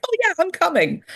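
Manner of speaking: high-pitched, excited dog's reply; eager dog. Acted. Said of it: "And then he'd be like".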